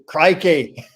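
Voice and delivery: put-on playful Aussie accent; Australian accent. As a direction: exclaim it in a mock Australian accent. Playing the Australian after accent jokes.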